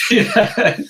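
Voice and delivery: amused, caught out by the guess; laughing yeah. Real, not acted. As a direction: laugh through the word. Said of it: "I knew there was one coming".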